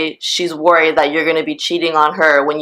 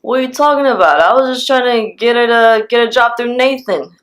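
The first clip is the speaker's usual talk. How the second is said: higher, mock-innocent protest; playing it off; 4 semitones higher than usual. Old guy deflecting an angry woman's questions, mock playing it off. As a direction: imitate him brushing off the accusation, voice raised. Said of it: "trying to play it off like".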